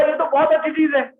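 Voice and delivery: lightly acted, high-pitched reply; Kali's eager reaction. Acts out Kali reacting to offer. (कली की ऊँची आवाज़ में हल्का अभिनय)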